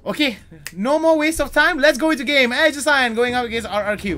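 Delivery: high, loud hype announcer; hype caster. Acted. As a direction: pump up the crowd. Hype game intro.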